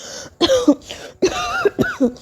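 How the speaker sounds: hard, hacking coughs; violent coughing fit. Acted. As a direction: cough as if choking. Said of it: "ज़ोर ज़ोर से खांसते हुए".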